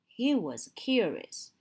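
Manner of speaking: usual speaking voice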